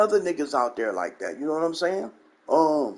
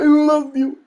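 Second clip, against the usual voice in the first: high, loud whiny mock-weeping gush; mocking YouTuber; 10 semitones higher, 6 dB louder than usual. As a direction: ham up the fake tearful love. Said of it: "talking about".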